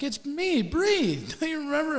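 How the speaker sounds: imitated nurse. Nurse reintroducing herself to an old acquaintance, imitated high voice. Acting the nurse who recognises him.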